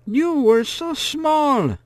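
put-on high, slow astonished old woman; amazed old woman. A narrator's reading. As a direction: marvel at how the child has grown. Woman recognizing the girl.

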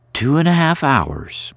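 put-on a brisk young woman scolding gently; Carrie's voice. A narrator's reading.

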